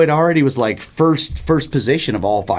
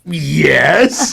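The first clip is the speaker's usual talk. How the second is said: louder, drawn-out yes; playful, emphatic admission; mock defiant yes; 3 semitones higher, 5 dB louder than usual. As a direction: answer mom with a stretched, louder yes. Replaying his answer to mom.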